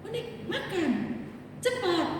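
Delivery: imitated mother-in-law, pitched far up; short, high commands to hurry and eat; high-toned order. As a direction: mimic an in-law's high-pitched urging. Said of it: "Kok nada suaranya agak tinggi gitu".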